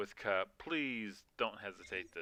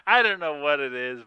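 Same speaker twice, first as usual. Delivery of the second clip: louder, amused repeat; laughing exclaim; 5 semitones higher, 13 dB louder than usual. Real, not acted. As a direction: blurt it out again through the laugh. Repeats the line after a laugh.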